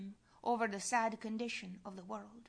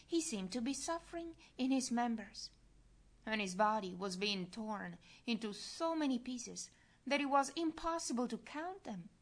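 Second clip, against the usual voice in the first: usual speaking voice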